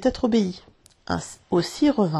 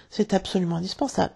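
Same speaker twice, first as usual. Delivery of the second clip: ordinary delivery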